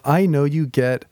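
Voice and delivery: slow, measured read aloud; gentle slow reading. Shown as a demonstration. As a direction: take it step by step, slowly. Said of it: "going slow".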